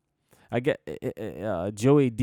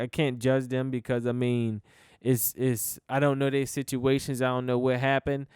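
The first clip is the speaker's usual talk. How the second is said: usual speaking voice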